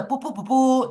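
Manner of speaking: high-pitched tooting; imitated nobat fanfare. Shown as a demonstration. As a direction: toot like horns announcing a king. Imitates the nobat fanfare once more.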